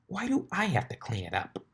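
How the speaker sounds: mild whine about chores; whiny complaint. Acted. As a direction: complain like a sulky kid. Rainbow whines.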